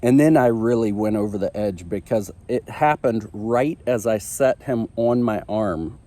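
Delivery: usual speaking voice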